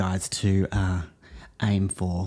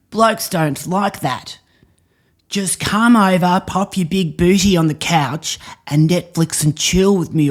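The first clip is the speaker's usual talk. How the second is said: higher, put-on blokey lecturing voice; hammed-up Aussie bloke; 5 semitones higher than usual. Improv actor playing a lout lecturing his girlfriend. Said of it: "and then he goes".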